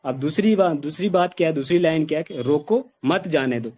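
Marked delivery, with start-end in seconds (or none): 2.4-3.7 full stop after "रोको", then "मत जाने दो"; "रोको" alone, break, "मत जाने दो" as one phrase; pause after रोको. Shown as a demonstration. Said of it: "रोको के बाद फुल स्टॉप लगाया".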